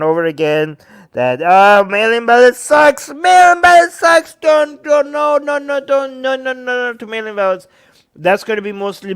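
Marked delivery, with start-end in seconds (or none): 1.4-7.7 high, loud hammed-up ranting; mock Trump. Trump ranting at supporters about ballots, loud high mock. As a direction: rant against mail ballots in a mock Trump voice. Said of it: "Trump kept on telling them".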